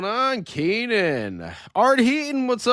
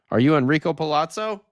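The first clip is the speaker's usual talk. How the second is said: ordinary talk, nothing marked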